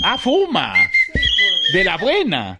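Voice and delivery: high-pitched scandalized exclamation; mock shock. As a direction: react with exaggerated high disbelief. Mock shock at clown's accusation. (asombro exagerado, escandalizado)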